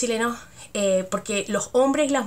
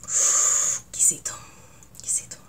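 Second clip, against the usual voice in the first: whispered exquisito; 4 dB quieter than usual. Real, not acted. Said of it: "seca la boca".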